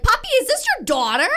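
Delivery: high, loud, stunned question; a dealer lady floored by the news; surprised car dealer lady. Retold or imitated. Car dealer lady asks if she's his daughter, high stunned voice. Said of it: "She was like".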